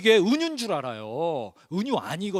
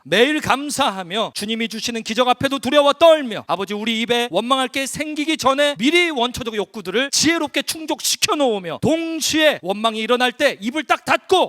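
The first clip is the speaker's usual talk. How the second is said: high, loud, driving public prayer; fervent prayer; 4 semitones higher, 6 dB louder than usual. Real, not acted. Preacher leading the congregation's closing prayer to God, fervent loud praying. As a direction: pray aloud with force, pressing through each phrase.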